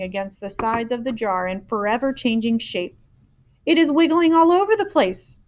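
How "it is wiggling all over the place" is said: put-on high excited girl; cried. A narrator's reading. Girl watching the dream thrash, excited. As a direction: exclaim in alarm. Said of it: "Sophie cried".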